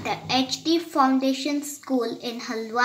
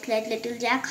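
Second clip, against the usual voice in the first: ordinary delivery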